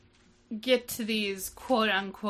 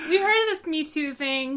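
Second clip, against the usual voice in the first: higher, louder mocking parody; 8 semitones higher, 8 dB louder than usual. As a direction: send it up with a sneer. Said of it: "is what that felt like".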